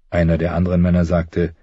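usual speaking voice

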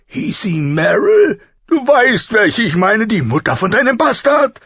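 high, loud, relishing royal voice with a laugh; boisterous reminiscing king. A narrator's reading. King prodding his friend about an old lover, boisterous. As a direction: grope for the name, loud and amused.

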